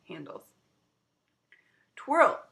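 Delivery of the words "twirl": loud, high command; order. Acted. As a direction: snap the order out to start. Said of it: "she ordered".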